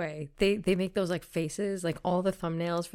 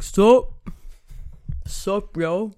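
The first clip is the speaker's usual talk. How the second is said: loud, higher, hammed-up dude greeting; mock cool greeting; 4 semitones higher, 10 dB louder, much slower than usual. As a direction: play a too-cool YouTuber. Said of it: "Say something cool like".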